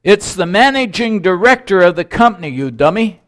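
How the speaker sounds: loud, high, quick self-important announcement; acted indignant boss putting a caller down; angry retort. Acted angry reply in joke.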